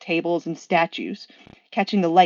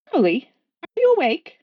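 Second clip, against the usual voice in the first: high, eager angel voice; Aziraphale's voice; 9 semitones higher, 6 dB louder than usual. Acted. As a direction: call out brightly to a sleeping friend. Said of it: "I will do voices".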